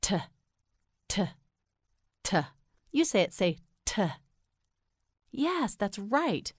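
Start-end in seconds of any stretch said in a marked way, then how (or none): 0.0-2.4 short isolated "T" puffs, a crisp tuh; T sound. Shown as a demonstration. Teacher shows how T sounds, short separated tuh. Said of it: "T sounds like this". 3.9-4.0 short "t" puff, like tuh; T sound. Shown as a demonstration. Teacher asks learner to say it, short isolated tuh. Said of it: "T sounds like this".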